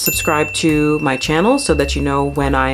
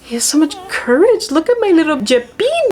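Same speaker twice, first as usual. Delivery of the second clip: high, swooning lovestruck voice, pitched well up; Rita swooning; 13 semitones higher than usual. Retold or imitated. Rita admiring her little Jeppino, high swooning coo. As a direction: coo it like a smitten opera heroine. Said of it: "Rita sees this and she's like".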